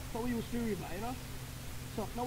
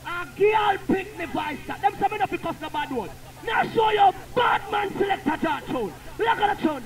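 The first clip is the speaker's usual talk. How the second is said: hype shout; 6 semitones higher, 4 dB louder than usual. Real, not acted. Selector shouting to the crowd about his tune. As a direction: shout the boast over the music.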